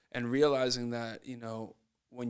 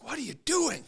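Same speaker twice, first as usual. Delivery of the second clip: high, squeaky mock coach yelling; 13 semitones higher than usual. Coach snapping at Shane after another foul, high mock voice. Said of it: "Coach was like".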